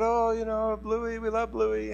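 mildly acted sad child's plea; sad kids. Upset kids pleading for their dog, lightly mimicked sadness. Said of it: "the kids were crying, and they're really sad".

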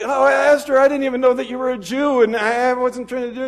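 loud, high, whiny grovel; hammed-up frantic begging; panicked pleading. Haman begs Esther in panic.

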